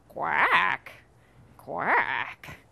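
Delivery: long, drawn-out falling quack; quacking duck. Acted. Duck picks up the fallen crown, drawn-out quacks. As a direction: stretch each quack out slowly.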